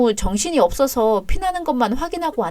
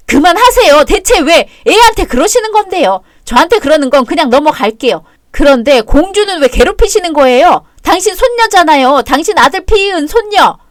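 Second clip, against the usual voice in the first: angry confrontation; 5 semitones higher, 7 dB louder than usual. Acted. Mother confronting mother-in-law.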